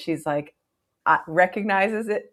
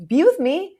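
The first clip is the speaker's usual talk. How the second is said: high, louder mock disbelief; incredulous retort; 12 semitones higher, 5 dB louder than usual. Retelling his reply to her promise of forever, incredulous. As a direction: squawk it back as an appalled question.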